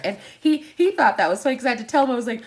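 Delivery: ordinary talk, nothing marked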